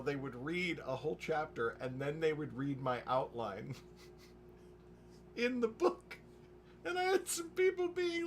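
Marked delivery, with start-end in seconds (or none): 4.5-8.3 very high, squeezed by laughter; cracking up. Real, not acted. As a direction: struggle to tell it through laughter. Cracking up at own blunder.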